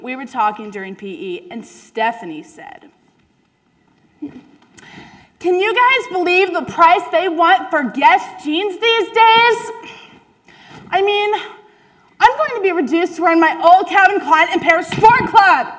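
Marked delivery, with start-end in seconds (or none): 4.2-15.7 put-on whiny rich girl. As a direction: complain about jean prices like a preppy girl. Stephanie voiced complaining about jeans.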